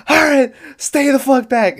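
high, strained movie-star yell; high-pitched retold shout; imitated yelling. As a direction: yell a warning, imitating an actor's outburst. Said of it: "yelling at the top of his voice".

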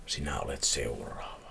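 low, hushed threat; soft, sinister note reading; ominous whisper. A narrator's reading. Threatening last line of the note.

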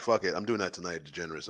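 ordinary talk, nothing marked

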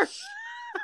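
squealed burst of laughter; high squealing laugh. Real, not acted. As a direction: let out a high giggle. Cracking up over the filter.

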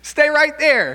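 high, loud warning call; mock call out. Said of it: "you'd wanna be like".

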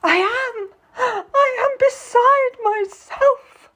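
high-pitched, tearful wail; distraught. A narrator's reading. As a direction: cry it out slowly, beside herself. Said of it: "You look quite distraught".

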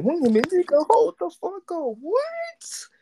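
a voice cracking high with shock; shocked disbelief. Retold or imitated. Friend hearing the rapper got shot, stunned high disbelief. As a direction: pitch shooting way up in stunned disbelief. Shocked reaction to shooting news.

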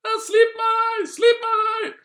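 slow, very high screaming plea; hammed-up panicked shriek; Dronning Sonja, terrified. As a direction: scream in mock terror as a comic queen. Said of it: "Dronning Sonja ble livredd".

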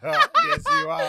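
put-on very high, loud teasing little girl; mock daughter teasing daddy, high and loud; squealing child. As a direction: squeal it like a gleeful toddler. Father voices daughter teasing.

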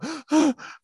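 imitated sharp, high gasp for air; sobbing gasp. Client failing to breathe as counselor urges him, imitated sobbing gasp. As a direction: suck in air as if hyperventilating.

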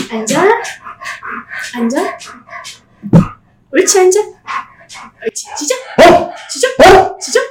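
firm short dog commands; talking to a dog. Real, not acted. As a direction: give the dog its orders, then praise. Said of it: "목소리 한번 들려줄까요?". (개에게 차분히 내리는 명령)

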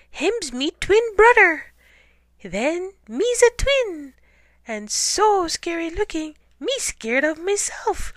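high, loud squealing panic; panicky hunter. Acted. Hunter realizing he has a twin, high panic. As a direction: shriek as the awful idea dawns.

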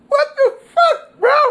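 high, loud, squealing through laughter; laughing shout. Real, not acted. Laughing at his own trap house joke, loud high squeal. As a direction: shriek it while cracking up.

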